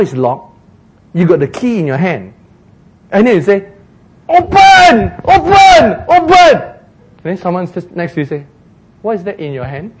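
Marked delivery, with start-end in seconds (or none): 4.3-6.6 high, loud repeated command; hammed-up shouted demand; mock frantic shouting. Acts out yelling at locked door. 9.0-9.9 understated bystander's query; quiet aside. Acted. Bystander quietly asks the shouter.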